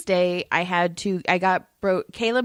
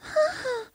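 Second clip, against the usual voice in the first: sharp high imitated gasp; dog gasp; 4 dB quieter than usual.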